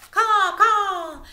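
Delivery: high loud cawing; shrill mock crow shriek. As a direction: caw like an alarmed crow.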